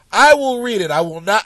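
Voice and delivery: normal talking voice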